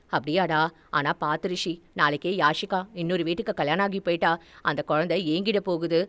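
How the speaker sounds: put-on low, softened older-relative voice; Meenambigai, concerned mother. Mother cautioning son about the baby, low and concerned.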